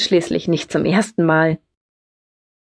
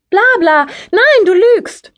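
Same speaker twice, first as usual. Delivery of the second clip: put-on very high, loud childish outburst; squealed, furious accusation; angry shouting back; 13 semitones higher, 6 dB louder than usual. A narrator's reading. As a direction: shout them down, stretching the words. Tearful sibling quarrel, shouting back.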